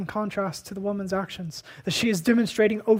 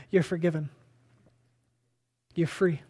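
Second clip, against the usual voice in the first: gentle release; 3 semitones lower than usual. Retold or imitated. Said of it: "he says".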